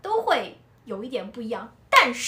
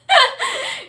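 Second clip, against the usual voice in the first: high, loud burst of laughter; 10 dB louder than usual. Real, not acted. She laughs at her husband's answer, high and loud.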